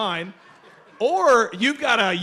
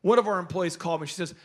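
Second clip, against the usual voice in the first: ordinary delivery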